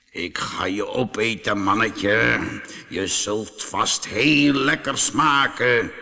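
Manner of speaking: a lightly menacing lion; growling lion. A narrator's reading. Lion threatening the little man, mild growl. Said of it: "en gromde".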